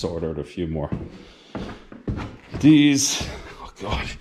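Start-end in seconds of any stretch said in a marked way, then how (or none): 2.6-4.1 breathless after climbing; out of breath. Real, not acted. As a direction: gasp it out, winded. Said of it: "I'm out of breath now".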